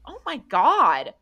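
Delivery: high, loud acted dismay; shocked exclamation. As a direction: react aghast to a broken arm. Betty examines the broken arm.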